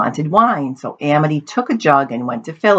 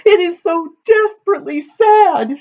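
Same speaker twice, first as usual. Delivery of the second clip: very high tearful wail; sobbing. A narrator's reading. Weeping daughter explains her grief, sobbing. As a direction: wail it like a storybook crybaby. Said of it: "sobbed Amity".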